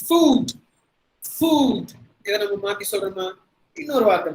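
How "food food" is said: FOOD with a long, drawn oo; higher, louder long-vowel demo. Shown as a demonstration. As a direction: stretch the oo in FOOD, pitched high. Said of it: "குரல்ல சொல்லக்கூடாது".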